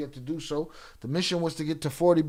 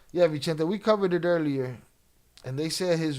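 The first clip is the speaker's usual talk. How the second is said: ordinary talk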